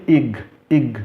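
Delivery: short isolated "ig", repeated; I com G. Shown as a demonstration. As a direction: join I and G into "ig". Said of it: "I com G". ("ig" curto, isolado)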